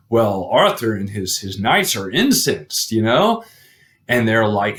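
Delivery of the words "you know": ordinary delivery, nothing marked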